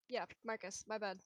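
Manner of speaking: ordinary talk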